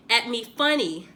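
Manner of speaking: ordinary delivery, nothing marked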